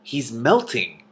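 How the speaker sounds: slow, matter-of-fact; deliberate, dry correction; correcting. A narrator's reading. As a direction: correct her calmly. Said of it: "Cimmerian corrected her".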